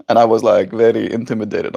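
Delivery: ordinary delivery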